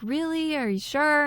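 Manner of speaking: high, hopeful disbelief, imitated; very excited. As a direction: act out a wife's delighted double-check. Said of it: "Sarah's very excited".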